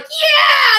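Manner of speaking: high, loud imitated kid cheer; one kid cheering. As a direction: whoop it like a gleeful child. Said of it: "And one kid's like".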